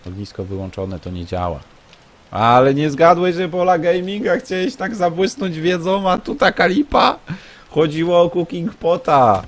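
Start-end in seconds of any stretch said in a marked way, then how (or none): 2.3-9.4 high, loud sing-song mockery of a know-it-all; mocking taunt. Real, not acted. Streamer mocking a chat viewer who gave a wrong tip, in a high taunting voice. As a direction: rub it in with a squeaky mock voice.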